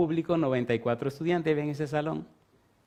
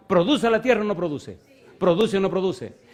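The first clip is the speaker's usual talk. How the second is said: insistent demand; 7 semitones higher, 6 dB louder than usual. Retold or imitated. Pressing teacher in debate.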